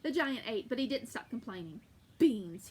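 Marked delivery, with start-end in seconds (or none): 2.2-2.7 loud, low grumble of beans; grumbled. Acted. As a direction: spit out the word. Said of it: "he grumbled".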